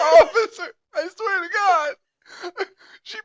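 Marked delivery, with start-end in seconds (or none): 0.0-1.9 very high, loud mock plea through laughter; laughing mock plea. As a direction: plead to an officer while cracking up. Joking mock plea to police, cracking up.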